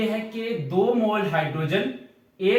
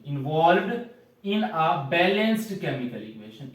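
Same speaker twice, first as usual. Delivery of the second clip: very slow, word by word, for copying; teacher dictating a definition to the class; slow dictation; much slower than usual. Shown as a demonstration. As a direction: dictate the definition at writing speed. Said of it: "किताब पर लिख लो".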